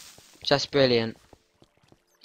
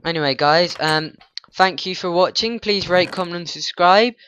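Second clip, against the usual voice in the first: louder, brighter sign-off to the viewers; upbeat outro; 4 semitones higher, 5 dB louder than usual. Real, not acted. Youtuber signing off to viewers, energetic outro. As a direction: sell the subscribe ask with energy.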